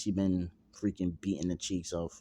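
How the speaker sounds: normal talking voice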